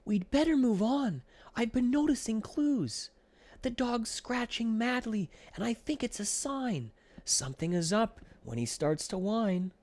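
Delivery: high, anxious little flea voice; worried Ed. A narrator's reading. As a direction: warn urgently in a tiny high voice. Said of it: "Ed came one day with some terrible news".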